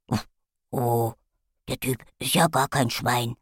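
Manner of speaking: soft, low, puzzled puppy; confused dog voice. A narrator's reading. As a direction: mumble it like a baffled dog. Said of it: "wuffte Bonsai verwirrt".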